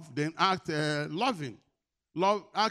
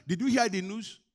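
high, loud mock alarm, easing off at the end; alarmed news-spreader; 4 semitones higher, 6 dB louder than usual. Believer spreading news of a bad economy, mock alarm. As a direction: imitate someone excitedly passing on bad news. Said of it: "we carry it in".

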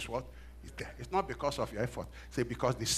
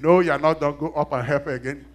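much louder, firm command; insistent voice; 10 dB louder than usual. Retold or imitated. Inner voice ordering him to help again, loud and firm. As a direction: push him back up the stairs. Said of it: "that voice said again".

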